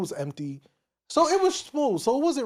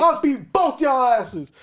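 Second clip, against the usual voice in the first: retold furious yelling; 3 semitones higher, 4 dB louder than usual. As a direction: shout him down, fully losing it. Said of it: "He's like, like".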